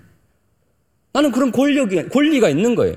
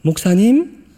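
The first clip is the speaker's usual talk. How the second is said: higher, questioning practice call; nervous second try; 7 semitones higher, 3 dB louder, slower than usual. Acted. Young evangelist practising again outside the pastor's office, nervous questioning. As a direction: try it out as an uncertain, rising question. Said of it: "앞에서 연습을 하는 거죠".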